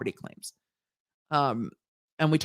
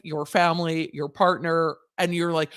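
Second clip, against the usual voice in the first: ordinary talk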